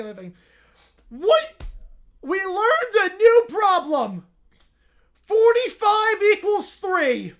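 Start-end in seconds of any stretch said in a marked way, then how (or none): 1.1-1.6 surprised. Real, not acted. Surprised reaction. 2.2-7.3 excited. Real, not acted. Mock-celebratory reading of problem.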